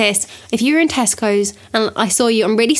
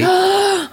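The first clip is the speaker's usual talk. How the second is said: mock gasp; 9 semitones higher than usual. Mock shock at arrears.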